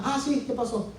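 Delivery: lightly voiced mother, high and soft; mother on the phone. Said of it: "y mi mamá".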